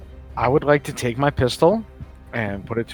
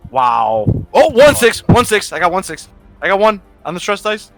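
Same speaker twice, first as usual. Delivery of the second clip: higher, louder excited burst; 8 semitones higher, 5 dB louder than usual. Real, not acted. Player announces a roll of one six to the table, excited. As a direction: blurt it out in real excitement.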